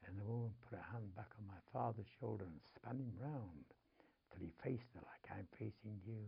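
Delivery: ordinary talk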